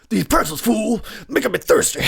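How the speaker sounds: half-hearted mock Mister T growl. Host joking to viewers, mild mock Mister T line. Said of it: "I should say it like Mister T".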